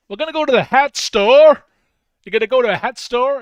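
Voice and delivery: loud, high, put-on American; mock American accent. Streamer announcing a trip to the hat store, mock American accent. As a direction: belt it out as a hammed-up American. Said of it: "Don't know why I'm being all American".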